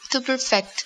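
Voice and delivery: perfect stressed on the last syllable, per-FECT; light first syllable, strong FECT in perfect; stress on second syllable. Shown as a demonstration. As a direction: say perfect as a verb, weight on FECT. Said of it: "emphasis is on the second syllable".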